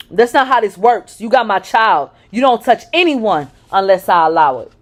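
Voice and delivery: loud, furious man; angry. Acted. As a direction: lay down the law in rage. Possessive boyfriend lays down rules.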